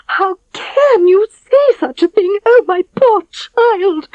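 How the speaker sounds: very high, slow, loud wail; wailing queen. A narrator's reading. Queen wailing over her daughter's fate. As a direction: wail it out in horror. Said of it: "wailed the queen".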